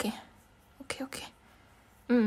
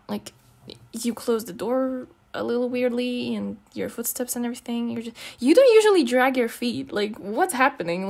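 ordinary talk, nothing marked

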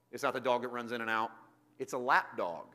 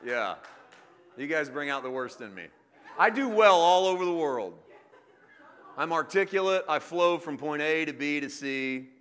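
hammed-up self-praise, louder; mock boast; 3 semitones higher, 8 dB louder than usual. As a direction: boast about yourself with a wink. Self-mocking boast to congregation.

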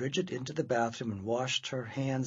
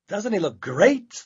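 higher, louder, slow and gleeful; proud showing off; 6 semitones higher, 9 dB louder than usual. Acted. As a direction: present him proudly. Girl proudly shows off.

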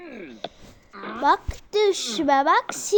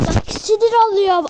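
ordinary delivery

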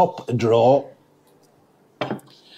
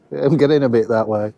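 usual speaking voice

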